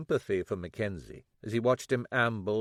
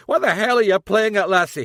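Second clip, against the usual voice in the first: loud, high furious roar; father yelling in rage; 10 semitones higher, 12 dB louder than usual. A narrator's reading. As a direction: explode at the daughter. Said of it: "he yelled".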